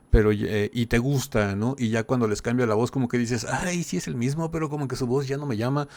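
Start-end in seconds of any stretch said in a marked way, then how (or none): 3.4-5.9 higher, softened mock reaction; imitating a disappointed listener, pitched up; let-down fan. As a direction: lightly act out a fan losing interest. Voicing a fan's imagined reaction.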